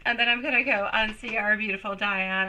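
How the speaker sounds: normal talking voice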